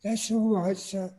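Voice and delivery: be the small polite elf in the crowded lift; Dobby the house elf, squeaky and polite; little elf voice. Retold or imitated. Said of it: "And he said".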